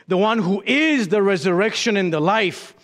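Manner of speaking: ordinary talk, nothing marked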